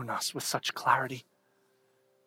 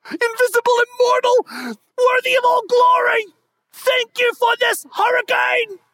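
shouted, much higher hammed-up praise; mock grand worship; 12 dB louder than usual. Pastor mocking his own prayer, shouted overblown praise. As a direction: overdo the pious cry. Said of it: "my response ends up just being like".

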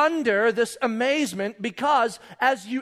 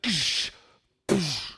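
crashing "ksh" noise; short hissed burst of spray; imitated boat splash; 5 semitones lower than usual. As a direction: mimic a hull slapping into waves. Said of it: "And the boat just goes".

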